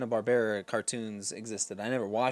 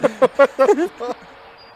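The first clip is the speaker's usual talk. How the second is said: squealing laugh at the joke; laughing too hard to talk; high helpless laughter; 14 semitones higher, 14 dB louder than usual. Real, not acted. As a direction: crack up high and loud. Cracking up at own story.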